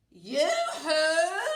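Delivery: loud, high yoo-hoo call. Acted. Witch calling out loudly across the meadow.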